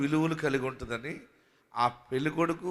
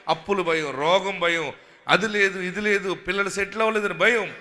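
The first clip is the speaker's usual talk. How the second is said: loud, rising preaching proclamation; full-force preaching; 8 dB louder than usual. Acted. Pastor declaring to the congregation, loud and fervent. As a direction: proclaim it over the crowd, building.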